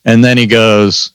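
ordinary talk, nothing marked

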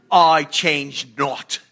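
put-on a preacher's clumsy stab at a British accent on each word; bad British accent. Pastor trying a British accent on God's words, a bit hammed-up. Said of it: "I'm really terrible at accents".

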